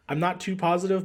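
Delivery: ordinary talk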